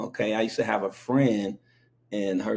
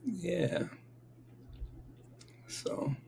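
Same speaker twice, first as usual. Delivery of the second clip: whisper; 7 semitones higher, 14 dB quieter than usual. Real, not acted.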